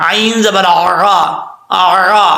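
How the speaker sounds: आ with a throaty ain, drawn out; clear ain. Shown as a demonstration. Teacher demonstrates clear ain slowly. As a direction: tight throaty ain, no hamza. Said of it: "आइन को clear करना है".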